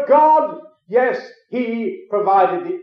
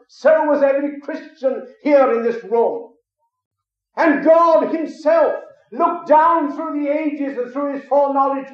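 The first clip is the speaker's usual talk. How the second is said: raised, fervent preaching voice; 6 semitones higher than usual. Acted. Preacher exhorting the congregation about being chosen, fervent. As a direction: preach it higher, driving the point home.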